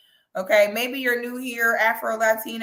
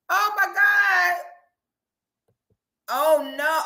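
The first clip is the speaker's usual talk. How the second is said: shocked; 7 semitones higher than usual. Real, not acted. Shocked laughing.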